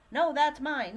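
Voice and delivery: put-on loud, high childish "mine!" protest; mock squabble. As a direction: play a grabby senior shouting "mine". Voicing seniors arguing over coasters.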